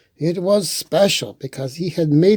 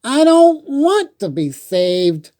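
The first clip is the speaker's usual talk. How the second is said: Beaver protesting; 12 semitones higher, 4 dB louder than usual. Acted.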